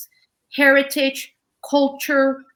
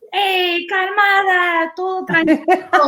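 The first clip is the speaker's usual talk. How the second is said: higher, slow soothing mom voice; mom calming her down; 7 semitones higher, 3 dB louder, slower than usual. Retold or imitated. Daughter imitating her mother telling her to calm down, high and slow. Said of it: "My mom is trying to".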